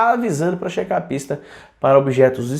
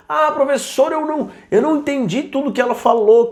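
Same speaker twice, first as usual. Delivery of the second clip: higher, pleading student voice; mock student; 8 semitones higher than usual. Teacher mimicking a confused student, higher and pleading. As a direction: play the lost student whining to the teacher.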